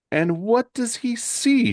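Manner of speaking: high, slow teasing question; suspenseful cue. A narrator's reading. Asking Shane for the big reveal, suspenseful. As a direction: pitch it way up and draw out the cliffhanger.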